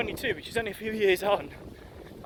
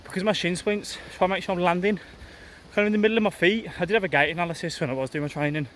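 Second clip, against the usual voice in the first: breathy, loud talk mid-run; speaking while jogging; 5 dB louder than usual. Real, not acted. Talks while running, loud.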